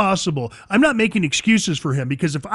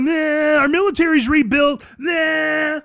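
mocking taunt; 6 semitones higher, slower than usual. Iranian leaders taunting America, mock shouted jeer. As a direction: jeer it like a kid sticking out his tongue. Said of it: "They're like".

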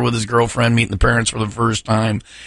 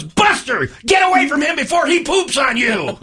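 mock shout at the dog; 13 semitones higher than usual. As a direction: yell it at the dog, hammed up. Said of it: "the dad looks at the dog and goes".

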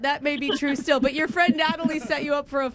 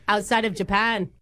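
chummy mock-Aussie patter; put-on Australian accent. Prank caller brags about Aussies to the interviewer, in fake Australian. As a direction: boast in a hammed-up Australian accent. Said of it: "Are you from Australia?".

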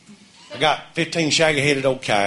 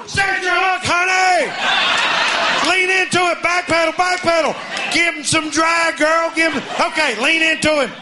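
excited coaching shouts; 12 semitones higher than usual. Retold or imitated. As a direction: yell instructions like a ringside coach. Coaching wife landing cow.

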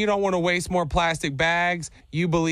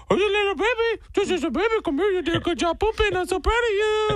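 put-on high cooing baby talk to a dog; puppy dog voice; 14 semitones higher than usual. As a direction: coo at the puppy in a squeaky voice. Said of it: "did you do weird puppy dog voice when you were talking to Flipper".

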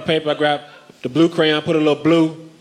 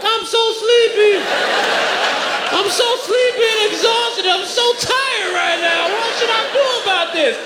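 put-on whiny baby voice; slower than usual. Voicing a sleepy crying baby.

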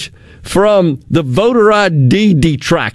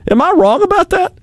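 incredulous challenge; 13 semitones higher, faster than usual. Real, not acted. Rhetorical challenge to co-host.

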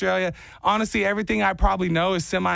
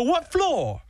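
higher, bad Jersey accent attempt; failed Jersey accent; 11 semitones higher than usual. Retrying the elevator guy, failed Jersey accent. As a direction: another stab at Jersey that falls flat. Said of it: "No, that's bad".

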